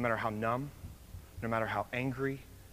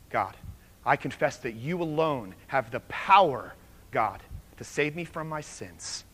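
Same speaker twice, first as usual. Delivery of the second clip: earnest spoken prayer, paced and deliberate; leading a prayer; 4 dB louder than usual. Acted. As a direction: pray it aloud for the room to follow. Leads a congregation prayer.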